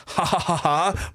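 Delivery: slow hammed-up evil laugh; mock gloating cackle; villain laugh. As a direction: cackle like a cartoon miser.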